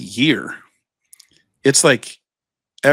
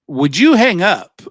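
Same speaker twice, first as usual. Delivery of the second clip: higher, louder and slow, fed up; exasperated; 6 semitones higher, 6 dB louder, slower than usual. Pretend. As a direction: finally snap at the endless caller. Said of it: "I'm waiting for one of them just go".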